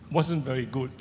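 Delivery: ordinary talk, nothing marked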